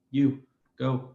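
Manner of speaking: normal talking voice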